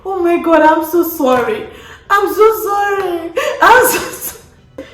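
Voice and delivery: whiny mock sobbing "I'm so sorry"; mock crying. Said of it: "I started crying".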